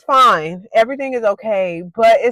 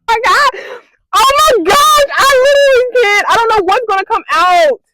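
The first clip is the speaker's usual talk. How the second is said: high, loud tearful outburst; near-sobbing shouts; panicked crying; 10 semitones higher, 5 dB louder than usual. Real, not acted. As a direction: wail in real disgust and fright. Freaking out after the bite.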